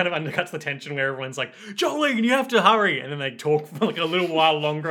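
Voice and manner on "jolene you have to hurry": high-pitched, urgent warning cry; imitated frantic plea, pitched way up; urgent mock cry. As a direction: play a panicked teammate calling out. Acting out the urgent characters.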